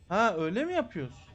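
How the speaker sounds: normal talking voice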